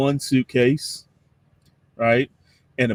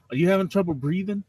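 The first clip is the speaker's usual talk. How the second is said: winded Cajun complaint; 7 semitones higher, faster than usual. Acted. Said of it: "thick Cajun".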